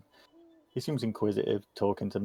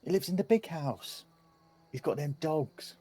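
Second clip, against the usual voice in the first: lightly voiced chatty child, higher pitch; inquisitive kid; 7 semitones higher, 4 dB quieter than usual. Kid tells strangers about the big house, lightly voiced child. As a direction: lightly play a gossipy youngster.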